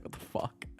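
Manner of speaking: whispered disbelief, very soft; retold under-the-breath reaction; baffled whisper. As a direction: mouth it in a stunned whisper.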